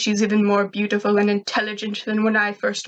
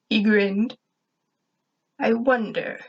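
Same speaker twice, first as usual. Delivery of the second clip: ordinary delivery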